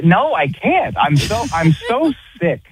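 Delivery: high-pitched fed-up protest; real exasperation, pitched high; exasperated. Real, not acted. Exasperated fan venting about his school.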